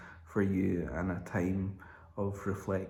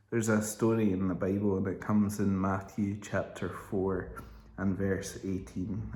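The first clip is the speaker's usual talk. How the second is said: normal talking voice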